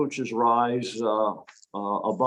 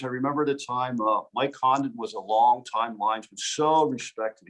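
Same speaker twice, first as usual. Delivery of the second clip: ordinary delivery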